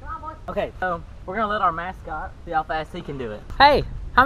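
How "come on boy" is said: high sing-song call to the dog. Real, not acted. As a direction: coax the dog over. Calling the dog.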